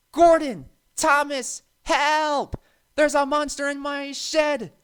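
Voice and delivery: high, loud panicked cries for help; wailing in terror. A narrator's reading. Percy calling the big engines in a panic, terrified wail. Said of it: "wailed Percy".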